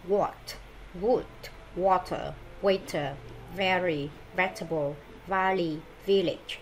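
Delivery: what, wood, water, waiter with a rounded W, then very, vegetable, valley, village with teeth on the lip for V; W and V words. Shown as a demonstration. Teacher modelling W and V words for the class, careful pronunciation. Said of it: "là âm W, à, W và V".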